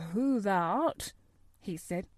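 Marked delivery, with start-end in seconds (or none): 0.1-1.1 lightly voiced old gardener, slow and shaken; queer shaky voice. A narrator's reading. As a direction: answer slowly in country dialect. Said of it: "in a queer, shaky voice".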